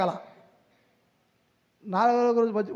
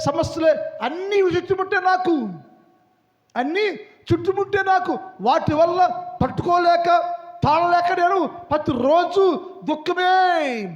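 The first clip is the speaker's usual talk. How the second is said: a raised, pleading lament of a suffering sinner; 6 semitones higher, 4 dB louder than usual. Acted. Sinner crying to God about endless suffering, raised and pleading. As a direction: cry out your misery to God.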